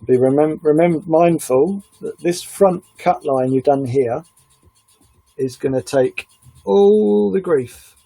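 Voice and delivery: slow, careful teaching delivery; calm slow instruction; tutorial voice. Acted. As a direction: guide the viewer step by step, slowly.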